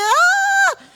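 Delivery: very high startled "ah"; a hammed-up shriek of horror; mock shriek.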